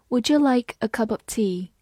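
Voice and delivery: "Would you" blended as "would-ju", "cup of" as "cu-pof"; linked reading. Shown as a demonstration. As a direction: read "Would you like a cup of tea" as connected speech. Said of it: "would和you之间会有个连读". (整句连读示范)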